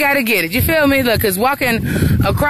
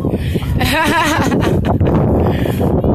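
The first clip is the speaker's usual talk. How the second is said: long amused laugh, pitched up; 5 semitones higher than usual. Real, not acted. Vlogger laughs while filming the game. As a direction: laugh it off after the scare.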